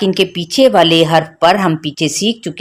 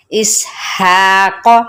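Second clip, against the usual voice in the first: इस हा कौ with हा held long, कौ short; stretched हा; slower than usual. Shown as a demonstration. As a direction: stretch हा, don't stretch कौ at all. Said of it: "हा को हमने खींचा". (हा को खींचें, कौ को छोटा रखें)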